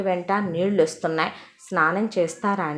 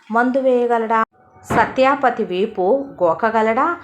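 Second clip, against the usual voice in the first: louder, scoffing rhetorical questions; scornful challenge; 3 semitones higher, 4 dB louder than usual. Acted. Sick father mocking the doctor's usefulness, loud scoffing. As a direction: jeer at what the doctor can do.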